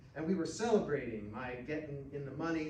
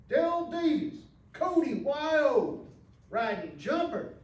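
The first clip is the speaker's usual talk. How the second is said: much higher and louder, booming arena call; rodeo announcer; 12 semitones higher, 10 dB louder than usual. Acted. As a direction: call it out over the whole arena. Rodeo announcer calls the rider.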